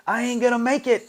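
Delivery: hammed-up plane panic; imitating Fred screaming. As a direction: mock-scream it like a scared flyer on a plane. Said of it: "the way he's screaming on a plane".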